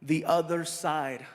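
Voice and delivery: low, measured formal introduction; solemn emcee delivery with pauses; stage announcer. Real, not acted. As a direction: build suspense in a deep, deliberate voice. Announces guest to audience.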